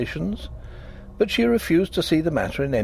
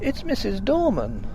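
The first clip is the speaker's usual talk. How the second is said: sobbing; 8 semitones higher than usual. A narrator's reading. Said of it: "she sobbed".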